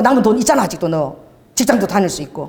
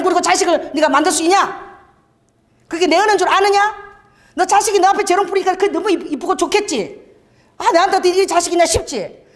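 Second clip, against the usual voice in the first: prophetic rebuke; 9 semitones higher than usual. Retold or imitated. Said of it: "바로 이놈이 나왔어".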